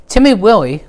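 ordinary delivery, nothing marked

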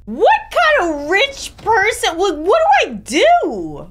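pitched high and slow, baffled; genuinely thrown by her own fancy house; surprised. Real, not acted. Player startled at her game character's wealth, high and baffled.

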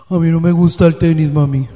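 lightly voiced boy speaking gruffly; a hint of a low, grumbling son; son's deep voice. Mother imitating her gruff son refusing tennis, lightly voiced. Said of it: "él habló grueso".

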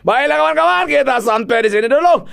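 high, loud sign-off to viewers; cheerful goodbye to the audience; vlog outro. Acted. (penutup vlog yang riang)

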